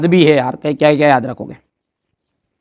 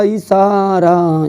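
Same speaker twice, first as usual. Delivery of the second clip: a long teasing drawl of इशारा; slow knowing hint; drawn-out hint; 3 semitones higher, much slower than usual. Acted. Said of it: "teasing hint".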